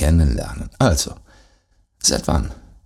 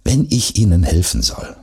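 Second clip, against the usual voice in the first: usual speaking voice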